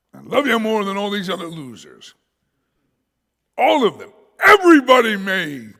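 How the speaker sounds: high, forceful declaration building to a shout; loud boast. Acted. Simon boasting to the Lord, building to a shout. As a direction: proclaim it loudly over everyone.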